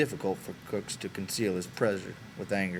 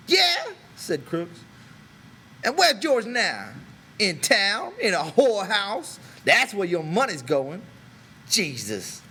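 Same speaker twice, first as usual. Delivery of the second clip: louder, high mocking jeer; scornful Crooks; 8 semitones higher, 5 dB louder than usual. A narrator's reading. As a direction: taunt them with where the money goes.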